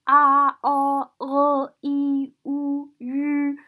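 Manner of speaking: "A, o, o, i, u, u" loud and level; flat-toned run of sustained vowels; vowel recitation. Shown as a demonstration. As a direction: hold each vowel on one even note. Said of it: "you can indicate tones on the six, uh, finals".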